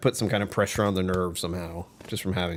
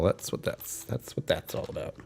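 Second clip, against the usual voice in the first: whisper; 3 semitones lower, 10 dB quieter than usual. Real, not acted. Confessing drinking past.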